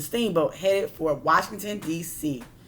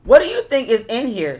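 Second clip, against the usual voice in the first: curious first man; 5 semitones higher, 8 dB louder than usual. A narrator's reading. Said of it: "said the first man".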